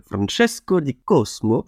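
slow, hammed-up Italian accent on the name; mock Italian accent. Vlogger names the bassist in mock Italian accent. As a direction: roll the name out like a proud Italian. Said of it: "Vous remercierez mon accent italien".

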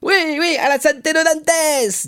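very high, loud tipsy cheer; drunken toast. Acted. Drunkard toasts his friend Dantès, in a high boozy cry. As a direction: raise a glass, squealing like a happy drunk. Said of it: "dit Caderousse en vidant son verre".

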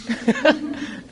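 short breathy laugh at her own slip of the tongue. Real, not acted. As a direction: laugh off the mistake, amused. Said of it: "Le lapsus". (petit rire gêné après un lapsus)

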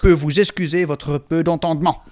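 put-on loud angry dwarf, Walloon accent; defiant Gimli in a Walloon accent. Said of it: "Gimli Wallon".

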